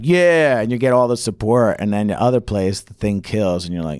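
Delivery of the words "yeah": loud, high hyped yeah; pumped yeah. Retold or imitated. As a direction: soak up the support.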